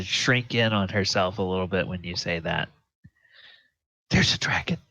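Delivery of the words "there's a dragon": frightened echo. Acted. NPC repeats in frightened voice.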